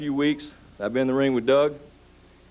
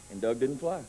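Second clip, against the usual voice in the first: usual speaking voice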